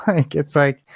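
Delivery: ordinary talk, nothing marked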